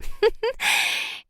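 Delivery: short, light, high chuckle; amused laugh. A narrator's reading. Woman laughing at his cheesy line, amused. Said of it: "I laugh at his cheesiness".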